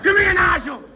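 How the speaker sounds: bully's shout. Acted. Bully calling Nigel over.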